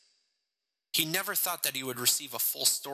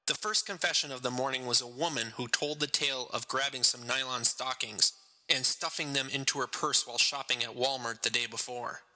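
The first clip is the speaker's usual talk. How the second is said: ordinary delivery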